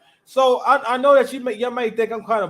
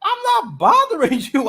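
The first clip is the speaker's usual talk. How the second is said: incredulous laugh; 7 semitones higher, 3 dB louder than usual. Retold or imitated. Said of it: "And I'm like".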